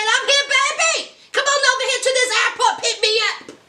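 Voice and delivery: a hint of a smooth boyfriend; Vincent's voice. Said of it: "Vincent said".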